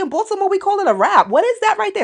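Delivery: very high-pitched New York accent; put-on New York accent, high and animated. Shown as a demonstration. Visitor arguing about hair words, high New York accent. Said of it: "give you my, um, New York accent".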